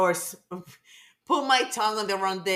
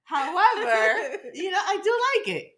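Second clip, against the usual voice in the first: laughing; 10 semitones higher than usual. Real, not acted. Laughing admission.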